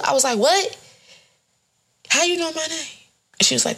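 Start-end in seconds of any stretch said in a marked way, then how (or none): 0.4-3.0 high, startled what and how you know; like someone just read your mind; stunned to hear her own name; shocked disbelief. Retold or imitated.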